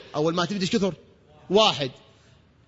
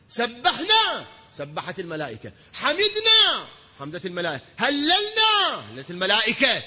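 impassioned preaching; 4 semitones higher than usual. Acted. Preacher rousing the congregation about angels learning praise, impassioned. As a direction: preach in waves, each praise word cried out.

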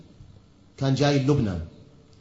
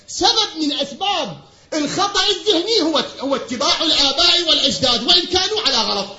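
full-force preaching; 12 semitones higher, 11 dB louder than usual. Acted. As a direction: thunder the point out to the congregation, drawing it out. (خطابة منبرية بصوت عالٍ وممدود)

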